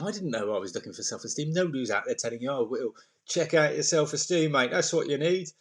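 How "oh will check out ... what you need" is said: lightly put-on Australian accent, chummy; a hint of Australian twang; mock Aussie bloke. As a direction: play a cheery Aussie mate. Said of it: "I'll put that accent on".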